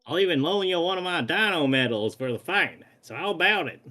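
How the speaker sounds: bad Western accent. Cowboy challenging a small fry, bad Western accent. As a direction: dare them in a corny Western accent. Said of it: "my incredibly bad Western accent".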